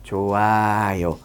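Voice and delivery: very slow, low, drawn-out sign-off; slow, gentle tone. Shown as a demonstration. Doctor shows viewers next time's manner, slow and gentle. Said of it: "천천하고 나긋나긋한 말투로".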